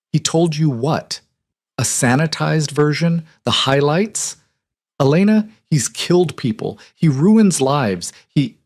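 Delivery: upset protest. A narrator's reading. Best friend pleading with Elena about her dangerous brother, upset and insistent. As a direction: push back hard, hurt and alarmed.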